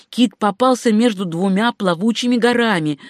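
usual speaking voice

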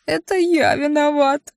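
high, slow little imp voice through tears; a guilty little demon, voice breaking; sobbing imp. A narrator's reading. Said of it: "всхлипнул имп". (тоненький голос бесёнка, всхлипывая)

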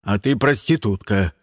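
low-pitched angry comeback; barked retort. A narrator's reading. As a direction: bark back at her in a low voice. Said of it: "рявкнул на неё Карл". (рявкает низким голосом)

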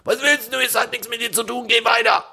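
high, rough mock yob snarl; aggressive guy. Driver imitates the angry car driver insulting him. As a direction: sneer it like a hothead picking a fight. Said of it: "anbrüllte".

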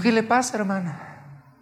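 high-pitched retold question to a church sister; retold perplexed asking; puzzled questioning.

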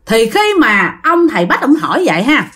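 ordinary delivery, nothing marked

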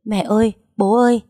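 acted wailing shout; tearful yelling. A narrator's reading. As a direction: call out desperately for mum and dad. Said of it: "Cô vừa khóc vừa gào lên".

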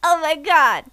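put-on high-pitched, gushing kid make-believe; seven-year-old storyteller. Reviewer mocking a seven-year-old's fantasy, childlike wonder. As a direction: marvel like a child inventing fantasy. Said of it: "seven-year-old would write".

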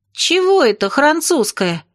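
high, slow indignant protest. A narrator's reading. Lyolya disputes French origin of dancing, indignant. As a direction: object hotly, pitch lifted. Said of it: "возмутилась Лёля".

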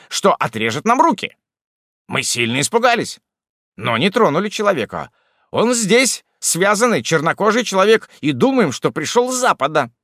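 high, loud anxious tribesman; terrified villager pouring out his story; frightened hillman voice. A narrator's reading. Hillman chief tells tale frightened.

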